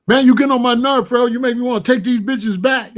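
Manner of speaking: irritated shop clerk losing patience; angry clerk. Retold or imitated. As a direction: snap at them, fed up and rushing. Clerk snaps at the customers.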